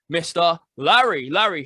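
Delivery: usual speaking voice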